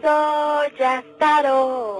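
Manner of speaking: very slow, loud, distorted declaration; demon Astaroth voice. Acted. The demon names itself to the host, slow and loud. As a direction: announce yourself as the demon. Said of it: "hablaba con voz de hombre".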